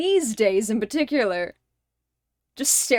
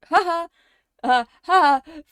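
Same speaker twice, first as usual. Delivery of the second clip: fake laugh; 6 semitones higher, 6 dB louder than usual. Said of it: "a funny neurotypical joke".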